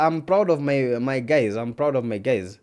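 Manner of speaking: normal talking voice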